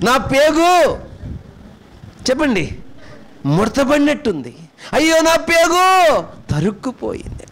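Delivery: a lightly voiced grieving mother, rising high; mother's anguished cry. Preacher voicing a mother whose heart breaks, mild anguished cry. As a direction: cry it out as a mother torn inside. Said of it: "తల్లి వాడద్ది".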